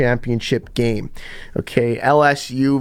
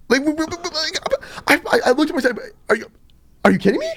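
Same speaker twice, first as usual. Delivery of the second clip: high, stuttering disbelief; stammering disbelief; 14 semitones higher, faster than usual. Retold or imitated. Acting out his reaction to a teammate, stammering disbelief. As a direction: sputter and restart, acting out being lost for words. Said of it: "I started beatboxing".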